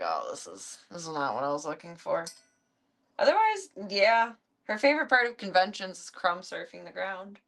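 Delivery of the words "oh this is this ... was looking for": much lower, softer disappointed cat voice; voicing the cat. Voicing the cat's letdown over lettuce, low and soft. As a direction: speak for the cat, low and let down. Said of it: "she's like".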